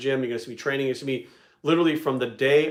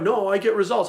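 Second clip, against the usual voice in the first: imitated insistent objection, pitched up; mock protesting; 6 semitones higher, 4 dB louder than usual. High-volume lifters argue with the coach, mock protesting. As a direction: play a defensive lifter arguing back, higher.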